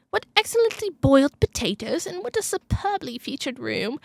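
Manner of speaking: Mister Collins impression. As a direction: quote him with pompous British politeness. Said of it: "a person in the movie called Mister Collins".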